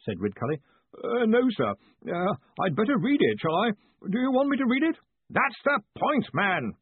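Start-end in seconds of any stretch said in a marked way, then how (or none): 1.0-5.0 high, hesitant timid voice; strained, deferential underling; nervous dithering. A narrator's reading. 5.3-6.7 high, raised retort; forceful, impatient boss; exasperated bluster. A narrator's reading. Ridcully exasperated, shouting.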